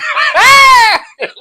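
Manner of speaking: very high, very loud shrieking laugh of delight; excited squealing laugh; screaming laugh. Retold or imitated. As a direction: shriek with joy at meeting a fan. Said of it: "we started hollering and screaming".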